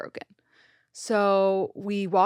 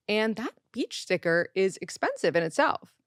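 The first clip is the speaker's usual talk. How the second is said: usual speaking voice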